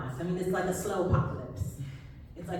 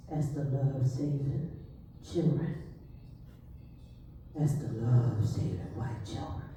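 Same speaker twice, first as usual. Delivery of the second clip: slow, low, ominous drawl; low, slow ominous mentor voice; Esther voice; 4 semitones lower, 6 dB quieter than usual. Shown as a demonstration. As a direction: lay each word down slowly and gravely. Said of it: "Esther talk like this".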